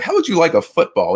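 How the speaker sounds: high, put-on voice from a film scene; Santa Claus. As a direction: imitate a department-store character making an offer. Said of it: "he's asking Santa Claus".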